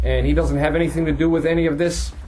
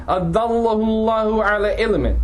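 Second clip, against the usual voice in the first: fast, chanted Quranic recitation set apart from his talk; tajweed recitation; 5 semitones higher, much faster than usual. Acted. Teacher reciting the verse before explaining it, in a chanted tajweed voice.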